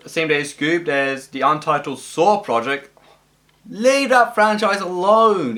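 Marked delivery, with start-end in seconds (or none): 3.7-5.6 exasperated. Real, not acted. Reacts to yet another Saw film.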